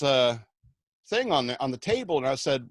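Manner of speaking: normal talking voice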